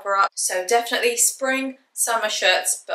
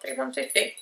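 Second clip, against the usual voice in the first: ordinary talk, nothing marked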